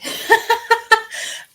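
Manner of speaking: high embarrassed laugh after choking. Real, not acted. Laughing off her choking fit.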